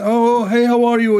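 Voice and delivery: high, drawn-out mock greeting; effusive high-pitched hello; chatty phone caller. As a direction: imitate a chatterer hailing everyone by phone. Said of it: "he's talking to everybody".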